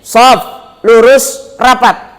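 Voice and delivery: tighten up the rows, firmer; stepped-up command to close ranks. Shown as a demonstration.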